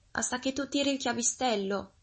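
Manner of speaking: high-pitched granny voice; storybook granny calling from her bed; high, feeble old woman; frail old granny. A narrator's reading. Bedridden granny answers.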